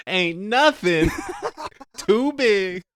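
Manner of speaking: high, drawn-out cartoon boast; SpongeBob dialogue impression. Quoting cartoon dialogue over the worm scene, hammed-up cartoon voice. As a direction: stretch it out loud and high like a cartoon. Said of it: "on repeat was just Sandy and SpongeBob dialogue".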